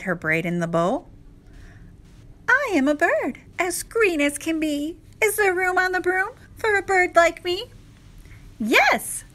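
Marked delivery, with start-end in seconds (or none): 2.5-7.7 very high, small bird character; little bird voice. A narrator's reading. Bird asks the witch for room on the broom, high-pitched voice. 8.6-9.2 high exclaimed yes; cried out. A narrator's reading. Witch welcomes the bird aboard, high cry. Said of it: "cried the witch".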